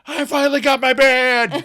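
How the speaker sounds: high, loud, laughing whoop; celebrating yell. Retold or imitated. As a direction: whoop it out in triumph. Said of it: "Frank celebrates ... yelling".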